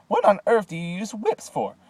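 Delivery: high, loud disbelieving question; indignant girl. A narrator's reading. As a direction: demand it, appalled. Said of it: "cried Veruca Salt".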